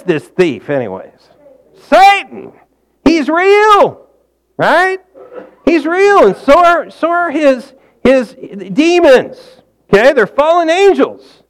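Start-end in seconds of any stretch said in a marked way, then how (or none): 1.7-11.4 fiery preaching. Acted. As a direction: preach it full force, warning of the enemy. Preaching about Satan at full force.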